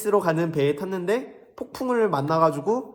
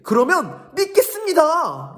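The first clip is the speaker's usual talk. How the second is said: much higher, louder, slow promise; mock-sincere Pharisee; 11 semitones higher, 5 dB louder than usual. Pharisees promising Jesus they'll believe, mock sincere. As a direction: promise it in a hammed-up sweet voice. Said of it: "라고 얘기한 거예요".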